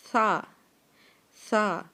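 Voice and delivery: سا with tongue between the teeth, no whistle; Arabic tha. Shown as a demonstration. Teacher shows سا without the s whistle. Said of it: "دانتوں کے درمیان میں رکھ کے زبان".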